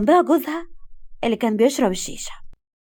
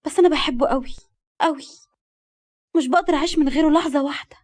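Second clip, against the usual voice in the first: higher, heartfelt plaint; lovelorn confession; 5 semitones higher than usual. A narrator's reading. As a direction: confess a love you can't help. Girl confesses desperate love. (اعتراف بحب بحرقة)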